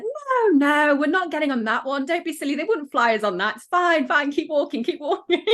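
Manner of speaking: hammed-up dismissive father, keep walking; dad brushing it off. Dad voiced reassuring her onto plane.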